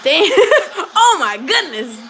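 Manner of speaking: slow, laughing disbelief. Real, not acted. Streamer cracking up at what just came in, laughing. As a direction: break up laughing mid-sentence.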